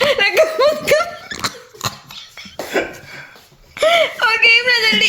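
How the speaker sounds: high squealy laughing words; can barely talk for laughing. Real, not acted. Laughing fit while filming.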